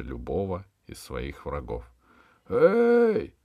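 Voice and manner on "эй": высокий громкий протяжный оклик; крикни, окликая издалека. A narrator's reading. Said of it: "закричал он". (высокий громкий крик)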